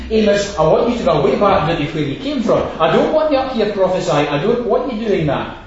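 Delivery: mock-stern command to leave; stern order. Amaziah ordering Amos away.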